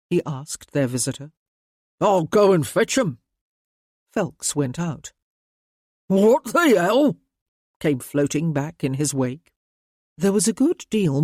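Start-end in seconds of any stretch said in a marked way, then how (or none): 2.0-3.2 rough ratcatcher. A narrator's reading. 6.1-7.2 louder, higher, slow startled oath; startled curse. A narrator's reading. Cursing from other room.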